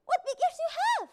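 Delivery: put-on wide-eyed child asking; high curious child voice; little girl asking. A narrator's reading. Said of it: "she said".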